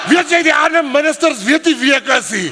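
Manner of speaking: high, loud imitated rant; excited shout. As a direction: holler it like a worked-up fighter. Retells Danny's outburst in Afrikaans.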